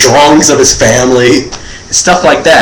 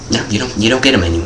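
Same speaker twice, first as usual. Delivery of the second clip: quick, much softer curt brush-off; brisk dismissal; 2 semitones lower, 8 dB quieter, faster than usual. Retold or imitated. Waitress quietly and quickly brushes off the kid asking for a menu.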